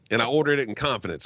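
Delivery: ordinary delivery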